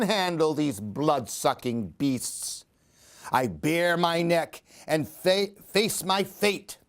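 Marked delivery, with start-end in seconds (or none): none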